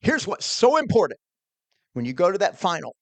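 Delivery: preacher voice. Retold or imitated. Old minister coaching a teen quizzer, emphatic preacher voice. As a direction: imitate a preacher driving the point home. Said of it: "He had this preacher voice".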